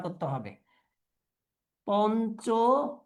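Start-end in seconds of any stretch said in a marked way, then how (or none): 1.9-3.0 slow, with a long gap mid-word; broken into parts. Shown as a demonstration. Teacher dictating to students, slowly in parts. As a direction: dictate it in measured pieces.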